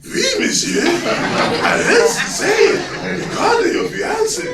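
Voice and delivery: very high, comic French waiter; pompous French accent. Retold or imitated. French waiter confirming the slip, pompous accent. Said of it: "in his pompous accent".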